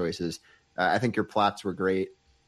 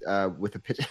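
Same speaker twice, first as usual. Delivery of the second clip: normal talking voice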